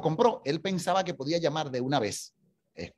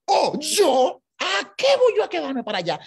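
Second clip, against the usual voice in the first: much higher, incredulous then firm; indignant surprise; 8 semitones higher, 3 dB louder than usual. Retold or imitated. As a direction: scoff at the idea, then insist. Isaac answers the consul.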